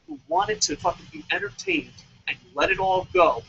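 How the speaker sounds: usual speaking voice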